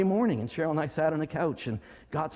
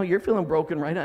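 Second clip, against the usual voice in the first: usual speaking voice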